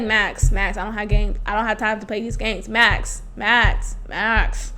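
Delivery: low, quiet, restrained irritation; kinda angry. Retold or imitated. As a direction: imitate a tired man getting over it, through clenched teeth. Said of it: "he's, like, kinda getting angry".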